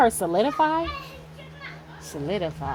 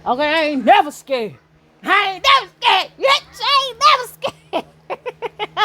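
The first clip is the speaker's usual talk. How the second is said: defiant shout; 9 semitones higher, 6 dB louder than usual. Real, not acted. Defying those tampering with phone.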